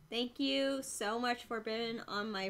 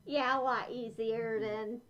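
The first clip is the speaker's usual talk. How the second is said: muffled inside mascot head; through a costume head; 3 semitones lower, 12 dB louder than usual. Real, not acted. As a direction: voice covered by mask. Seen 2: Speaker wears full fursuit head over face throughout; not in refs.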